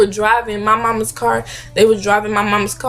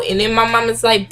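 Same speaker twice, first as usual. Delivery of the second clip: ordinary talk, nothing marked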